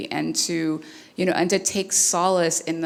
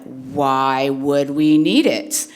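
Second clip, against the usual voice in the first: put-on drawn-out, louder why-question of a small child; five-year-old's questioning; 6 dB louder, much slower than usual. Five-year-old son questioning mom on the bus, slow and insistent. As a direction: stretch it out like a baffled little kid. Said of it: "he's five".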